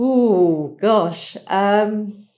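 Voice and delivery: a loud, startled "ooh, gosh"; taken aback by a hard question, exclaim it loud and high; surprised exclamation. Real, not acted. Asked how the divorce affected her, a startled loud ooh.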